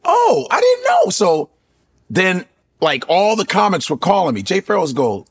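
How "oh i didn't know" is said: mock high surprise. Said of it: "I said".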